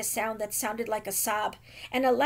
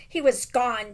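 ordinary delivery, nothing marked

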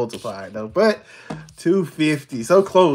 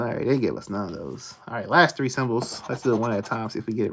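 usual speaking voice

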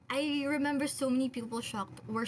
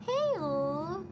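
higher, louder chirpy "Heyo!"; bright greeting on request; 6 semitones higher, 3 dB louder than usual. Shown as a demonstration. Asked by a fan to greet, higher and louder chirpy "Heyo!". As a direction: throw out "Heyo!" as a cheerful hello. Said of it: "Can you say heyo?".

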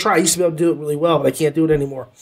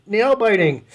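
"Nail-biting" echoed higher with a falling finish; emphatic repeat; 6 semitones higher than usual. Shown as a demonstration. Reviewer savouring the praise he just gave, emphatic. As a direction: relish the word "Nail-biting".

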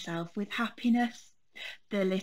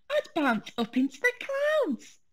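much higher, delighted squeal; picture it and squeal with glee; high gleeful cub imagining the tree; excited little bear. Acted. Fred imagines bouncing up.

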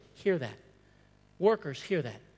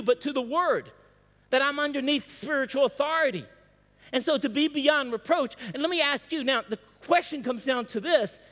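higher-pitched, emphatic pulpit delivery; fervent preaching; 7 semitones higher, 4 dB louder than usual. Real, not acted. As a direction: press each point home to the congregation. Preaching with rising fervour.

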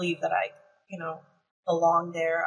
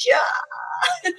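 inner cheer. Retold or imitated. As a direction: yelp a thrilled inner yes. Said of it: "But my mind was like".

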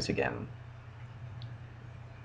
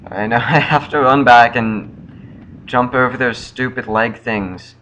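normal talking voice

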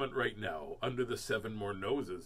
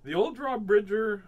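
lively storybook read, a bit higher; newsy storybook voice; story reading; 4 semitones higher, 5 dB louder than usual. A narrator's reading. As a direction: announce it like a kids' story. Reading aloud to children.

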